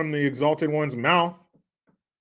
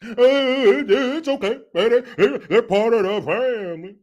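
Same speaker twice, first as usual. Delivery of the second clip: mocking politician impression, higher pitch; Barry Obama; 5 semitones higher, 4 dB louder than usual. Host repeats politician's line to the crowd, mocking impression. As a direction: stammer it out like a folksy politician. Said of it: "Now you hear his voice".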